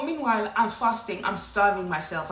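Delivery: usual speaking voice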